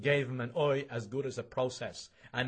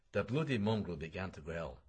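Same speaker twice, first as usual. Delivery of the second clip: ordinary delivery, nothing marked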